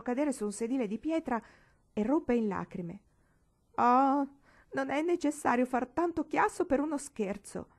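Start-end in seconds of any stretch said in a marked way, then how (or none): 3.8-7.7 higher, louder tearful protest with little real crying; a touch of sobbing through it; mild mock sobbing. Paola broken down on a stone bench, mildly tearful protest. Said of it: "singhiozzò".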